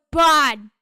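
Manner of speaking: very loud one-word shout; calling out. Acted. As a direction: shout it over to a buddy. Character calls out across the group.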